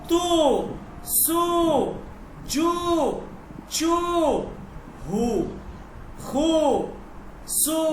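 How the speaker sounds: each syllable from "তু" to "ফু" stretched on oo; chant-like drill, long oo in "তু", "সু", "জু"; long drawn-out vowels. Shown as a demonstration. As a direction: hold every oo from "তু" to "ফু". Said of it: "এই ধরনের লম্বা একটা টান দিবেন".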